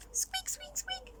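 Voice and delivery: very high, soft squeaks; tiny mouse squeaks. Acted. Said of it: "said the skeleton mouse".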